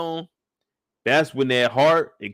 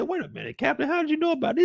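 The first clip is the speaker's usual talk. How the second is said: higher, quick and startled; surprised protest; 3 dB quieter, faster than usual. Retold or imitated. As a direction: caught off guard, asking how he knew. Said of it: "She said".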